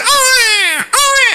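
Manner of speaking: high imitated child's wail; mock bawling in two long high cries; tangis anak. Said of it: "Ada anak nangis". (tiruan tangis anak bernada tinggi)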